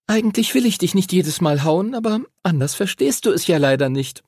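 put-on high-pitched girl making peace; Lucy, half-apologetic girl. Lucy makes peace with Henry, high half-apologetic voice. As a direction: explain it in a high child's voice.

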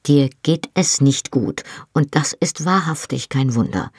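ordinary delivery, nothing marked

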